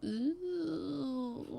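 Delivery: high, drawn-out "ooh" of dismay; dismayed groan. Acted. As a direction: groan in mock dread. Dreading a high school setting.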